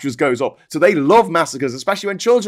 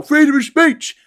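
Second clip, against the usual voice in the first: mock American cry; 11 semitones higher, 4 dB louder than usual. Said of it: "when they go".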